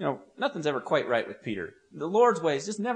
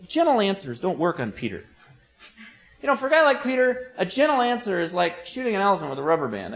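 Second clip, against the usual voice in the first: ordinary talk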